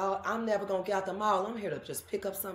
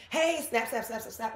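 imitating pushy fan; 5 semitones higher than usual. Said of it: "somebody's got a camera in my face".

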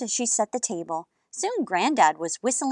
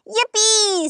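excited yippee; 11 semitones higher, 4 dB louder than usual. A narrator's reading. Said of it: "said Amelia Bedelia".

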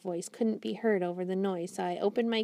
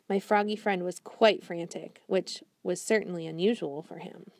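ordinary delivery, nothing marked

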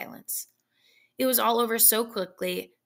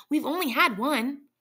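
eager; 5 semitones higher, 3 dB louder than usual. A narrator's reading. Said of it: "she replied quickly".

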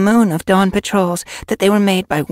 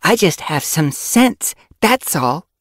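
loud, emphatic telling-off, pitched up; furious; 8 dB louder than usual. A narrator's reading. Apprentice argues for fetching their mentors, furious. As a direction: argue forcefully, with controlled fury. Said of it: "voice was low and furious".

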